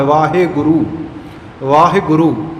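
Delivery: ਵਾਹਿਗੁਰੂ in full, "vaa-he-gu-roo"; ਵਾਹਿਗੁਰੂ with long aa, clear he, long oo; correct full pronunciation. Shown as a demonstration. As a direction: sound every syllable of ਵਾਹਿਗੁਰੂ, pausing between. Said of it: "ਸ਼ੁੱਧ ਉਚਾਰਨ".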